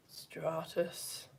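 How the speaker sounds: very soft, low, listless stratus; bored voice. Shown as a demonstration. Teacher demonstrating the dullest cloud, hushed bored voice. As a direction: let it out with no energy at all. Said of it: "in the most bored voice you possibly can".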